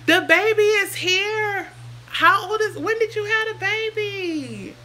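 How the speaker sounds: high, loud and thrilled; excited. Real, not acted. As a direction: squeal with real delight at the news. Excited at hearing of baby.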